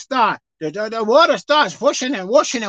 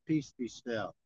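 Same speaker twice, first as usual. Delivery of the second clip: much lower and softer, calm command; calm quiet command; 17 dB quieter, slower than usual. Acted. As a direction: still the sea without raising the voice. Lord calmly rebukes the sea.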